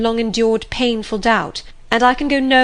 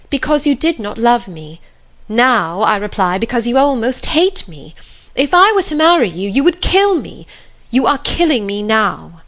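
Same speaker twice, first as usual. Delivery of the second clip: impassioned outburst; 3 semitones higher, 5 dB louder than usual. Acted. Jane refuses and accuses St. John.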